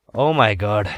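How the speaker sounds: ordinary talk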